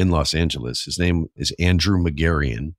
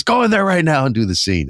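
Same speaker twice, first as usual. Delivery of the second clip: high, louder repeated command; insistent shout; 11 semitones higher, 4 dB louder than usual. Retold or imitated. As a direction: bark it at him higher this time. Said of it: "And he goes".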